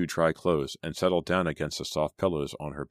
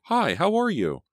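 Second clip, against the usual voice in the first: very high, loud gushing greeting; excited cry; 14 semitones higher, 5 dB louder than usual. A narrator's reading. Selena greeting Eddie, excited. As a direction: pitch it right up, thrilled. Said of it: "she cried".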